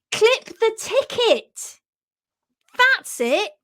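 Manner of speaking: high, slow, drawn-out mimicry of a friend; mimicking Jenny. Recalling friend's puzzling phrase, mimicking her voice high and slow. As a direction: stretch it out high as a teasing imitation. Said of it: "I've mimicked your voice there".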